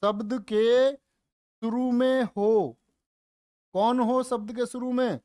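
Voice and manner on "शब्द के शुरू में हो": very slow dictated phrase; teacher reading a rule slowly to copy; slow dictation. Shown as a demonstration. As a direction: dictate it word by word. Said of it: "rule dictation".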